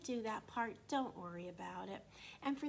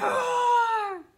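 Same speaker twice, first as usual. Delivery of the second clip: loud, high mock roar; hammed-up dinosaur roar; 13 semitones higher, 17 dB louder than usual. Shown as a demonstration. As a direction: roar big for the kids. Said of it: "Can you help me say this?".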